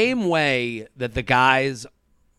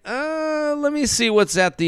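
mock dithering; 11 semitones higher than usual. Men mimicking her shopping around, mock dithering. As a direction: imitate someone idly weighing other options, pitched up.

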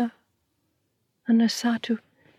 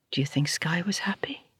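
ordinary delivery

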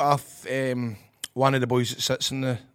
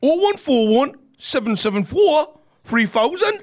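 loud, slow, raised Geordie digits; attempted Geordie accent; 11 semitones higher, 8 dB louder, slower than usual. Shown as a demonstration. Guest reads the taxi number on request, attempted Geordie accent. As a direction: call the number out in bad Geordie. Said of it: "What's the number in a Geordie accent?".

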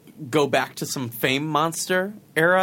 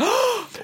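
sharp high gasp; shocked gasp. Real, not acted. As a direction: gasp in delight. Big gasp at wedding news.

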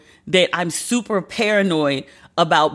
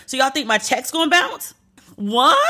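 incredulous disbelief; 7 semitones higher than usual. Real, not acted. Ranting about bank holding check.

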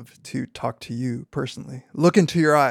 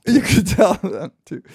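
laughing, pitched up; 5 semitones higher, 4 dB louder than usual. Real, not acted. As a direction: echo it through a laugh. Laughing echo of quip.

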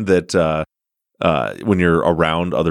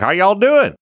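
high, loud mock Southern drawl greeting; a cheery hammed-up Southern accent hello; 13 semitones higher, 4 dB louder than usual. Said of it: "he had a real thick Southern drawl".

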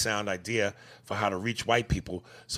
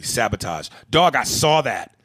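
ordinary delivery

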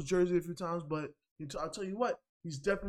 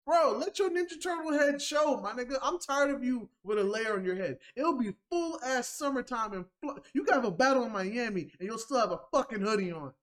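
mock scolding; 5 semitones higher than usual. Real, not acted. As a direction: scold him like an annoyed friend. Mock lecture to Twerk.

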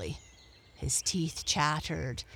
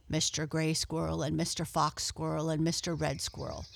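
usual speaking voice

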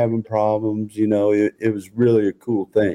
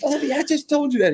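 high, fast incredulous complaint; mock exasperation; faster than usual. Coach protesting to Lovey, high fast and exasperated. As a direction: complain in a pitched-up disbelieving voice. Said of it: "I was like".

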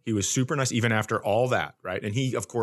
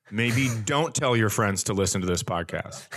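usual speaking voice

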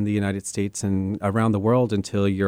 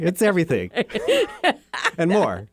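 laughing through the words; 6 semitones higher than usual. Real, not acted. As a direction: laugh out everything and more. Laughs at tough history question.

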